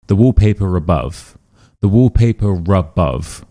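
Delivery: "wallpaper above" with an R sounded between the words; linking R. Shown as a demonstration. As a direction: link "wallpaper above" with a clear R. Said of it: "we do actually include this R".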